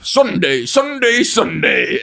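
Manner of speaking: hammed-up monster truck promo call; rally announcer shout. Grimace calls out while flipping, mock rally announcer.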